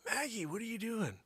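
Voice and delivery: high puzzled call; high calling question. Retold or imitated. Owner calling to noisy dog.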